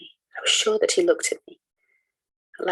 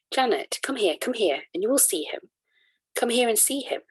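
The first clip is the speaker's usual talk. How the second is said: higher, urgent summons; calling out eagerly; 5 semitones higher than usual. A narrator's reading. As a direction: call her over impatiently. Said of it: "I called to her".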